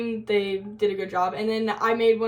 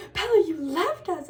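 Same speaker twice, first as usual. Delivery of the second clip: high, hurt-sounding imitation of a friend; mock accusation; 8 semitones higher than usual. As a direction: playfully accuse them of abandoning you.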